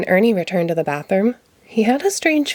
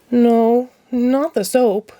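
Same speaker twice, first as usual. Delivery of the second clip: No, not the soap, drawn out; lightly voiced slow no; patient Ernie correction; 5 semitones higher, much slower than usual. A narrator's reading. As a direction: Ernie gently correcting the detective.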